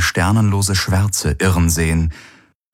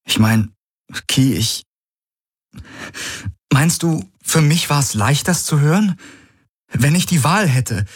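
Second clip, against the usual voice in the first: pleading sorrow, pitch climbing; full of sorrow; 9 semitones higher than usual. Acted. Man defending his silence to his partner, sorrowful. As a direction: start controlled, rise into grief. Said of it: "Seine Stimme war ruhig, aber voller Kummer".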